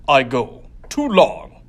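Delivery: loud, broken-English alien thug; alien gang leader voice. Acted. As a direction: bark it like a one-eyed brute.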